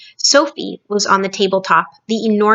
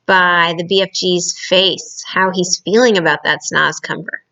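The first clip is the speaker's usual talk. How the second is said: ordinary delivery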